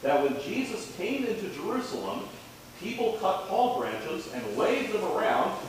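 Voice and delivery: put-on lively children's sermon voice. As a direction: talk gently to the children up front.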